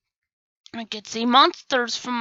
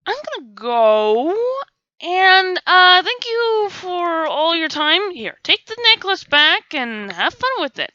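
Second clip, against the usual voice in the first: high, slow nervous excuse; nervous backing out; 7 semitones higher, 3 dB louder, slower than usual. Retold or imitated. Backing away from the insane ninja, nervous. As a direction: edge away politely, rattled. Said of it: "I was like".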